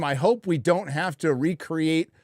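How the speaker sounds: ordinary talk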